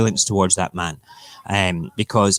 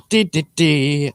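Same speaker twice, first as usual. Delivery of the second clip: mocking dee dee dee; 5 semitones higher, slower than usual. Mocking noise after Brown.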